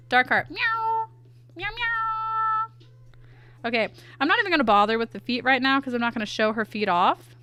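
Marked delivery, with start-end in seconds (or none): 0.5-2.9 very high, sustained mews; cat meows. Pretend. Streamer drawing a kitty character, high meows. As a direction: play a little cat, meowing high.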